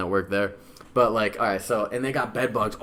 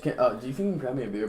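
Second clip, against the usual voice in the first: normal talking voice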